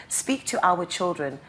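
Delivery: ordinary talk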